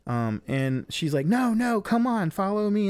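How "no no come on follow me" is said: high-pitched retold girlfriend pleading; insistent urging. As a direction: urge him on, voice pitched way up. Retold girlfriend urging him along.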